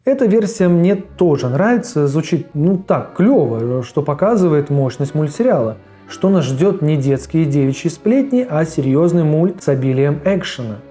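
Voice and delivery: usual speaking voice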